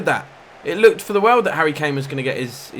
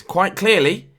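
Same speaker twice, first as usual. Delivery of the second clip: normal talking voice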